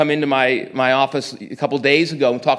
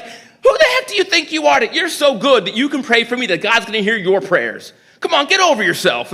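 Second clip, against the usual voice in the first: higher, louder retold tirade with a laugh in it; mock indignant rebuke; 7 semitones higher, 4 dB louder than usual. Homeless man scolding the pastor, loud mock outrage. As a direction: play the affronted street guy, half laughing.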